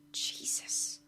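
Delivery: whispered exasperation. Acted. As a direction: sigh the word under your breath.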